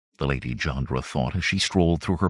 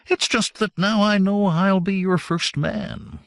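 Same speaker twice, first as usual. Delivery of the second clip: complacent; 10 semitones higher than usual. A narrator's reading. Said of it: "His tone was complacent".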